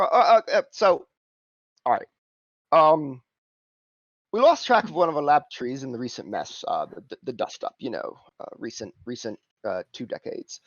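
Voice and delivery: lightly voiced cockney fixer; Simon's cockney voice. GM as Simon pitching the job, lightly cockney. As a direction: haggle in a cocky cockney lilt.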